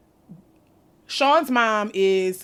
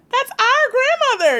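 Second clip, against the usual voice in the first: mock protest; 7 dB louder than usual. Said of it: "I'm like".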